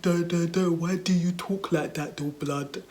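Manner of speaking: jeering mimicry of a D-for-th accent; mocking schoolkids. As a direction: imitate kids mocking someone's accent. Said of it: "made me feel like shit about it".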